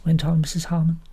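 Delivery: plain reading voice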